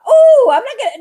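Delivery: high, bright burst of retold excitement; excited. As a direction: blurt it out, thrilled to catch the launch. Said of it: "I came in a little excited".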